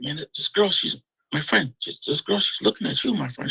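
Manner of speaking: high, broken English voice of a little man; a friend nudging him in broken English. Retold or imitated. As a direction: do the little guy who speaks English horribly. Midget guy voiced with broken English.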